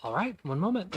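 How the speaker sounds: lightly voiced server stepping away; half-elf server. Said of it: "she vanishes back into the kitchen".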